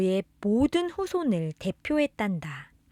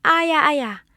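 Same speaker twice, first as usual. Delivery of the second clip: mock cry of ouch, higher and louder; acted pain; 5 semitones higher, 5 dB louder than usual. Narrator showing a child how bodies hurt, mock pained cry. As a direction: act out a little hurt, raised pitch.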